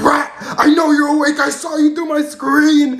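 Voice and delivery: loud, slow drill-sergeant yell. Retold or imitated. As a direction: bellow at a cadet, drawn out. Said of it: "literally yelling at me".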